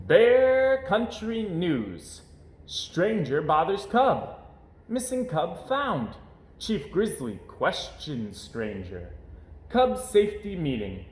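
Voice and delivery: slow, weighty headline announcing; headline read-out. Acted. Narrator reading the newspaper headlines, slow announcer. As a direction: announce each headline slowly.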